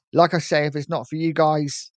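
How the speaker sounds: ordinary delivery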